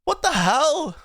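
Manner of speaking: high, amused disbelief; exasperated. Real, not acted.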